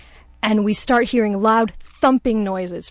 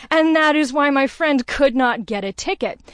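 usual speaking voice

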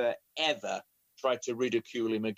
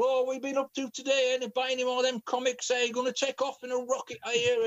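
high, fast teasing voice of the men; mocking jeer; 11 semitones higher than usual. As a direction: tease him like a playground bully. Men mocking uncle at fire.

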